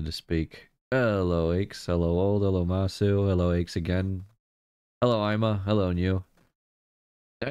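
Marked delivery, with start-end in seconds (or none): none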